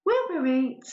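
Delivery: put-on playful Northern accent as the wife to Harry; mock Northern accent. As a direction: land the Northern punchline broadly. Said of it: "I've clearly mastered dialect".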